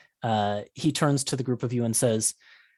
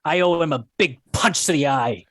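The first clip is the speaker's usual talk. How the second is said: angry; 4 semitones higher, 6 dB louder than usual. Acted. Angry dwarf wants to punch.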